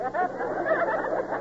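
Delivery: a short high-pitched giggle; crooked judge giggling at his own ruling. Acted. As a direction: giggle at your own cleverness. Judge laughs at his own logic.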